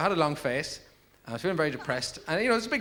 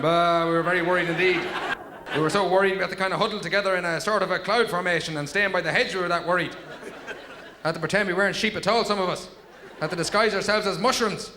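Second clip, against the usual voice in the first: raised, louder comic sheep voice; worried sheep chorus; 4 semitones higher, 3 dB louder than usual. Acted. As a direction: plead like a flock of worried sheep. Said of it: "all the other sheep up in the gallery going".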